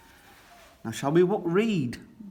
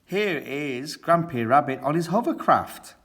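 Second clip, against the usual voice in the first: ordinary delivery, nothing marked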